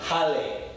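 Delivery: "jale" as HAH-leh, breathy h; Spanish J. Shown as a demonstration. Teacher modelling the Spanish J. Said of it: "The J sounds like the H".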